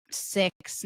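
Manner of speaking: ordinary delivery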